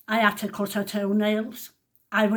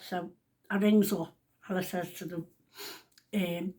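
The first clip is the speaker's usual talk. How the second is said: ordinary talk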